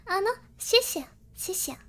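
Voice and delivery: ordinary talk, nothing marked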